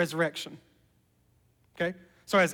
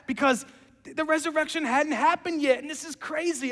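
much higher, wondering; amazed marveling; 9 semitones higher than usual. Acted. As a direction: marvel aloud as it clicks. John marvels at Nicodemus.

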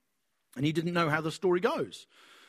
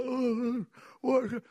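half asleep; 7 semitones higher than usual. Retold or imitated. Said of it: "He's half asleep".

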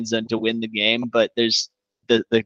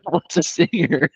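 laughing; 4 semitones higher, slower than usual. Real, not acted. Repeating the meme, amused.

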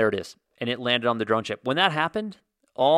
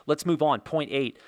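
ordinary delivery

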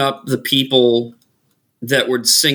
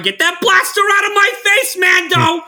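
much higher, yelling tough-guy command; Bill Burr shout. Retold or imitated. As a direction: bark it at Mando like an angry Boston thug. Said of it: "when he's on Mandalorian".

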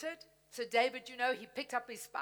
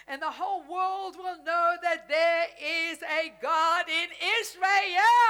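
mock shout; 7 dB louder than usual. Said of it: "it says, David shouted".

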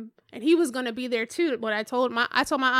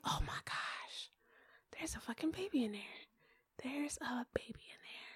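awestruck whisper; 15 dB quieter than usual. Retold or imitated. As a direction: whisper it as the realization sinks in. Retold shock at seeing the ultrasound.

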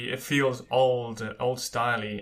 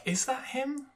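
surprised; 12 semitones higher, 4 dB quieter than usual. Retold or imitated. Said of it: "you're like".

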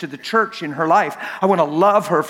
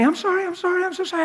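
imitating crying wife; 12 semitones higher, faster than usual. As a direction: plead like the wife in tears. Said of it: "My wife ran to me".

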